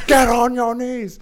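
high, loud barked command; yelling. Retold or imitated. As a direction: yell it as an urgent order. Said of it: "The guy's yelling at me".

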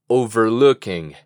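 Overlooking said slowly and clearly; slow model word. Shown as a demonstration. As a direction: drill Overlooking slowly for learners. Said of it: "Repite conmigo".

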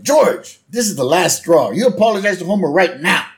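loud, high scolding wife impression; Barbara. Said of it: "And Barbara's like".

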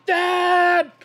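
put-on high shouted child's call of Dad; little boy hollering Dad up the stairs. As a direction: yell Dad like an excited five-year-old running to find him. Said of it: "and he goes".

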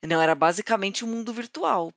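normal talking voice

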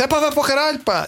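mock outburst. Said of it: "virei-me para um deles e disse".

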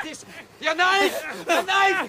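high, loud call for help; frantic, high-pitched demand; urgent shout. Acted. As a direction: yell for a weapon mid-struggle. Calls for a knife mid-fight.

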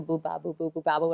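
put-on bobo and babbo said like a two-year-old; toddler babble. Mother imitating her toddler saying bobo. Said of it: "her two favourite things in life were her blankie and her bobo".

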